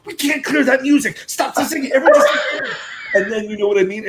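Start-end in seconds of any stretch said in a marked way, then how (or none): 0.1-2.7 higher, louder frantic pleading; a stressed director begging them to stop; panicked director. Retold or imitated. Director panicking over music rights, frantic high pleading. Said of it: "Dan's having a thrombo because he's going".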